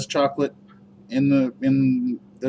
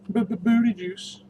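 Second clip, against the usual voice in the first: high, slow stutter on B-b-booty; stuttered silly voice; 11 semitones higher, much slower than usual. Pretend. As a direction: drag out B-b-booty juice in a goofy high voice. Playing with product name.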